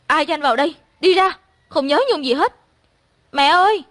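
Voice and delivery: high-pitched distressed protest; acted tearful outburst, rising to a loud call; upset, starting to cry. A narrator's reading. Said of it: "Tôi bắt đầu khóc".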